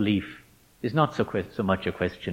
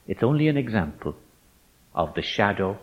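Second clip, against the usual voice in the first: usual speaking voice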